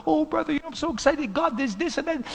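high, gushing excitement; re-enacted breathless enthusiasm of a fresh convert; excited new believer. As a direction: gush to a church brother, pitch way up. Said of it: "oh, I was so excited".

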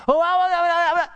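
high loud stammering mockery. Preacher mocking a stammering promiser, high and loud. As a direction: stutter like a flustered excuse-maker.

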